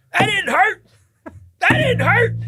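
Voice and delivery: very high, strained captain insisting it didn't hurt; mock pained denial. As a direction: squeak out the denial while in pain. Hurt captain denies pain.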